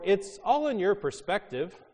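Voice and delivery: upbeat optimist. Retold or imitated. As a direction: chirp it like an optimist.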